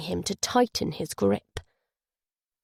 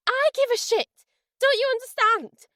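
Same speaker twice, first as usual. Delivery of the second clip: high, loud and desperate; furious shout; 3 dB louder than usual. A narrator's reading. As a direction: scream it at him, pleading to be understood. Camilla shouts back at Dale.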